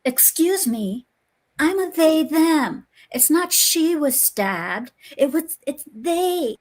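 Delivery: high, slow, fussy correcting voice; mock prissy correction. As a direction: parody a dying victim fussing over pronouns. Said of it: "all of a sudden goes".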